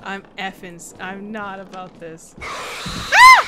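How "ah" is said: piercingly high startled scream; scream of fright. Real, not acted. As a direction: scream loud at a sudden scare. Jump scare in horror game.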